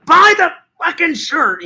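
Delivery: high-pitched pretend screaming demand; comic enraged outburst; mock fury. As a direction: lose it at a customer, hammed up. Acting out attack on customer.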